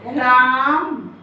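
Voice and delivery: राम stretched very slowly, the vowel held long; drawn-out example word. Shown as a demonstration. Teacher stretching राम to show pluta length. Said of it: "राम बोलने में आप र के बाद म".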